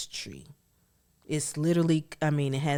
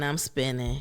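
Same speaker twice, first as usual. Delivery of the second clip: usual speaking voice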